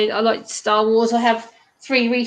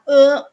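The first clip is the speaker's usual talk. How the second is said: disgusted ugh; 3 semitones higher, 6 dB louder than usual. Retold or imitated. Disgust at the smell of tea.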